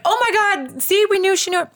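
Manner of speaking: high-pitched elated outburst; freaking out. Retold or imitated. Friend celebrates that she knew it, freaking out. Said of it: "we're freaking out".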